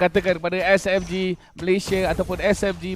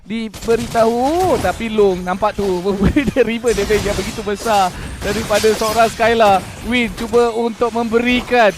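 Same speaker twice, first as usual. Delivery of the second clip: building hype, louder into the kill call; excited; 3 semitones higher, 8 dB louder than usual. Real, not acted. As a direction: shout the play as it lands.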